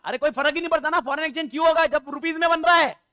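high, loud, exasperated rhetorical question; exasperated retort. Acted. Teacher answering a student's imagined objection, exasperated.

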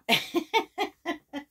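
high burst of laughter. Real, not acted. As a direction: laugh at your own joke. Laughs at own joke.

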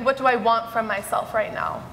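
ordinary delivery

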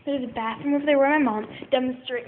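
usual speaking voice

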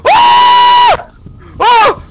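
sudden high, loud scream; real startled shriek, then a short yelp; scream of fright. Real, not acted. Startled in horror game.

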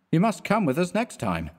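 lifted, friendly call of farewell; light friendly invitation. A narrator's reading. Friends urging him to come along, friendly and lifted.

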